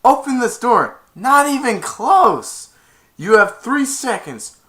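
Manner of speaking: loud, high, slow ultimatum; hammed-up menacing yell; threatening countdown. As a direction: count down like a bully. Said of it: "And he went".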